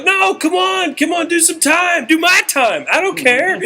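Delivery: high-pitched, insistent begging; starstruck pleading. Retold or imitated. Opener begs star to take his time, starstruck. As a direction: push excitedly, very high, like an overeager fan. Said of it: "I'm so starstruck".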